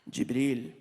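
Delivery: low, composed princely voice; dignified prince. Retold or imitated. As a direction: repeat the name regally, deep in the chest. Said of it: "And he's like".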